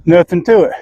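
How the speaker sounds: panting between words; breathless after hard work; out of breath. Real, not acted. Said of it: "That's why I'm out of breath".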